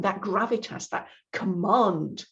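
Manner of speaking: usual speaking voice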